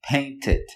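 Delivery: "painted." with two syllables, ending in a spoken "id"; correct pronunciation. Shown as a demonstration. Teacher modeling the past tense "painted." for students. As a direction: say "painted." with a full extra "id" syllable. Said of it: "Una vez más".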